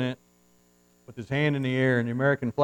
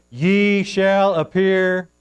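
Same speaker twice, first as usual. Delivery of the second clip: drawn-out scripture; 7 semitones higher, 8 dB louder, much slower than usual. Acted. Preacher reading the verse to the congregation, slow and loud. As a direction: stretch each word slowly and loudly.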